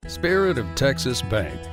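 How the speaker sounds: ordinary talk, nothing marked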